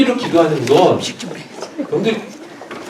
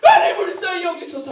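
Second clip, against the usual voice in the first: very high, straining cry; louder shouted plea. Acted. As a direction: shout over the crowd for mercy. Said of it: "더 크게".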